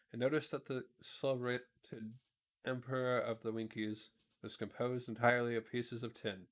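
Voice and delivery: usual speaking voice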